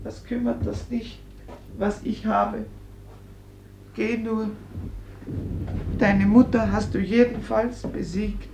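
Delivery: quietly lays the guilt on him; bitter hurt. Acted. Injured mother to her son: soft, wounded bitterness.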